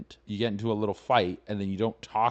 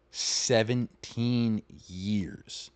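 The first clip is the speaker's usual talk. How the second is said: "seventeen years" stretched very slowly; drawn-out emphasis; much slower than usual. Real, not acted. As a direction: draw out each syllable of "seventeen years" in disbelief. Stresses how long they were married.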